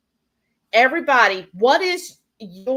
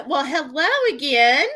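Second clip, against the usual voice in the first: normal talking voice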